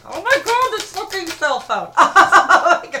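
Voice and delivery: high, loud, laughing frustration; laughing exasperation. Real, not acted.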